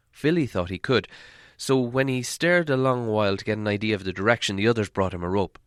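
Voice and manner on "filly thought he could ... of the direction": ordinary delivery, nothing marked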